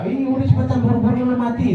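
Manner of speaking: high-pitched, playful hurrying; urging dead believer. Pretend. Dead believer hurrying the living to join, high-pitched urging. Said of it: "kata yang udah mati itu orang mukmin".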